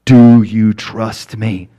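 slow, loud, deliberate voice of God; God's voice. Retold or imitated. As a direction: ask it again slowly and firmly. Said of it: "And God says".

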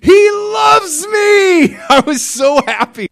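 very high, loud, slow joyful shout; ecstatic shout. Retold or imitated. Recalling God's love, ecstatic high shout. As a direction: burst out with overwhelming joy. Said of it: "to the heights of ecstasy".

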